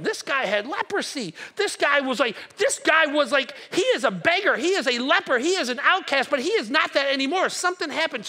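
the crowd can't believe it; excited crowd. Acted.

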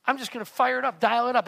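loud, driving self-motivation; pumped-up resolve. Acted. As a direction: punch it out loudly like psyching yourself up. Acting out determined push to work.

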